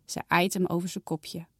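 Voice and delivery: ordinary delivery, nothing marked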